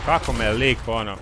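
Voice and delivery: loud exasperated complaint; frustrated outburst. Real, not acted. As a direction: vent loudly after losing. Complaining after being killed.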